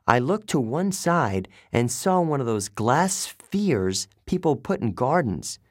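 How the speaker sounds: usual speaking voice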